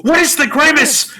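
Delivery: high, loud desperate plea in character; hammed-up frantic demand, pitched high; Jimmy Stewart. Jimmy begging Uncle Billy for answers, frantic and high. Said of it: "And Jimmy's like".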